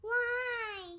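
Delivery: loud high whiny why. Acted. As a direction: whine it like a toddler. Child George asking why.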